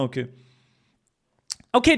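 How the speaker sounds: ordinary talk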